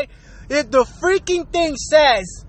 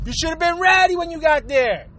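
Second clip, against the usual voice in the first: ordinary talk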